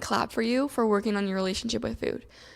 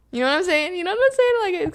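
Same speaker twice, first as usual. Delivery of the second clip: high, loud giggly burst; laughing; 8 semitones higher, 5 dB louder, faster than usual. Real, not acted. Admitting her inner dialogue sounds silly, laughing. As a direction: let it rise into a laugh at yourself. Joking at her own words.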